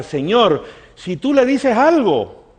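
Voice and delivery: loud, high appeal to God; acted-out urgent plea; pleading prayer.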